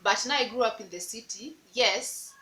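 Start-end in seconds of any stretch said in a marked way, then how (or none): none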